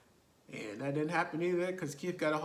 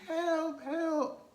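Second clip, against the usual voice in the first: retold screaming for help; 13 semitones higher than usual. Victim fleeing down the stairs, screaming for help. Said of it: "and she's screaming".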